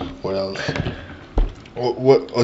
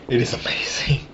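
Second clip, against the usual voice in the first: quieter whispered repeat; soft whisper; 8 dB quieter than usual. Real, not acted. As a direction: say it softly again, still amazed. Savoring beer, repeated.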